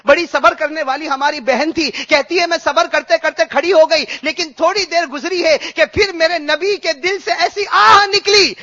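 high, loud, fervent sermon delivery; preaching at full force. Acted. Preacher stirring the congregation with a story of weeping, preaching at full force. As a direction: preach it out with swelling passion.

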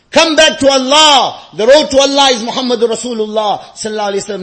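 loud, forceful preaching; impassioned exhortation. Real, not acted. Preacher calling congregation back to Allah, impassioned. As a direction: call them back at full voice.

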